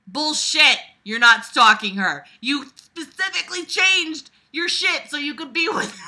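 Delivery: loud, high accusing shout; indignant shout; yelling. Real, not acted. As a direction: call out the lie at full volume. Yelling at the character.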